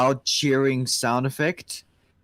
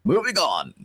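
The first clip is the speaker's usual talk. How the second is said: normal talking voice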